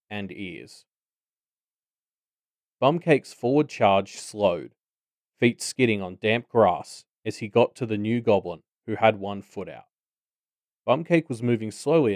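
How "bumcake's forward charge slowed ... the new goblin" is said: ordinary delivery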